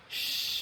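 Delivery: soft breathy shush; whispered shh. Pretend. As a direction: hush it like a piglet snore. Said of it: "sabi daw ng pig".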